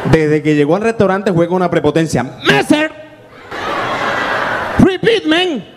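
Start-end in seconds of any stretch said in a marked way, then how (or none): 2.5-5.6 cocky customer. Retold or imitated. Bossy customer with prepotencia.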